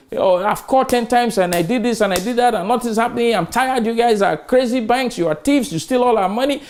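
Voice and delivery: high, complaining rant; a lightly voiced furious caller; mock angry customer. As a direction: vent like a fed-up bank customer. Angry bank customer retold.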